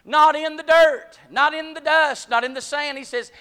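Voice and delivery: loud, high, slow preaching climax; preaching at full force. Acted. Preacher calls the church out of the desert, rising to a shout. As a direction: build to a shout, hammering each not and out.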